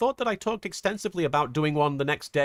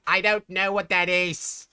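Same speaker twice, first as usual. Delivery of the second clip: Marek voice; 3 semitones higher, slower than usual. Retold or imitated. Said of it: "who'd be like".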